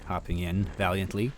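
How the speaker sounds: usual speaking voice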